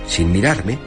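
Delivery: usual speaking voice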